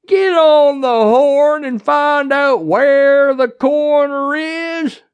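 high, loud, drawn-out holler; slow shouted command; yelled order. A narrator's reading. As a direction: bellow an order to a deputy. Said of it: "before yelling".

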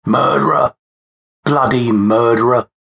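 high accusing cry from a mob; angry crowd heckle, narrated; jeering shout. A narrator's reading. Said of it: "began to shout and jeer".

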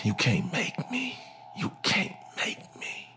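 soft, whispered defiance; murmured under breath. Retold or imitated. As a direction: mutter it barely aloud. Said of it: "I murmured under my breath".

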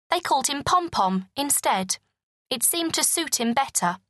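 plain reading voice

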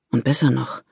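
usual speaking voice

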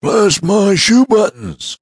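very high, slow, loud gasp of alarm; gasped exclamation. Acted. Mister Damon hearing Tom is in danger, shocked gasp. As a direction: gasp out his pet oath in shock. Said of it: "gasped Mister Damon".

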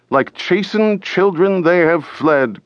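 high, gleeful jeer; mock the fled enemy with glee; jeering cry. A narrator's reading. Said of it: "cried another".

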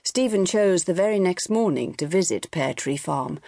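ordinary delivery, nothing marked

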